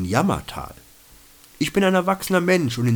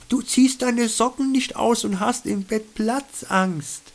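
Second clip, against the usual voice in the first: higher, lightly voiced female companion stating a fact; a higher voice for the companion, dry observation; companion's voice; 12 semitones higher than usual. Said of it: "stellte meine Begleiterin denn auch fest". (Begleiterin, höher gesprochen, trocken feststellend)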